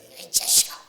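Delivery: loud, rough mock telling-off; rude scolding. Preacher demonstrates rudeness to a youngster, rude scolding. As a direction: bawl it out rudely. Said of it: "이게 무례한 거잖아요".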